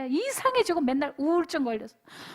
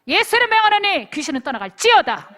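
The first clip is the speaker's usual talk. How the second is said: loud, higher commanding decree; commanding exorcism; 4 semitones higher, 8 dB louder than usual. Shown as a demonstration. Preacher showing the teens how to cast out demons, commanding. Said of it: "이러면 되잖아".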